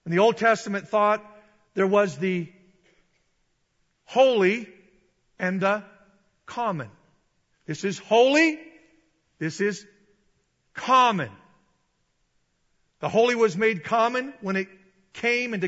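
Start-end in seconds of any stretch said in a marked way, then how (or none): 4.1-4.7 "holy" loud and high, rising; stressed key word. Shown as a demonstration. Preacher contrasting two categories, "holy" loud with rising tune. 7.7-8.6 "holy" loud, high and rising; stress on holy. Shown as a demonstration. Preacher setting holy against common, "holy" raised loud. 10.7-11.3 "common" loud, high, then falling; stress on common. Shown as a demonstration. Preacher setting common against holy, "common" loud and falling.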